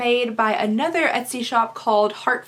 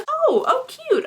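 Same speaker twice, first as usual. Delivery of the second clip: excited; 14 semitones higher, slower than usual. Real, not acted. Delighted at an unboxed item.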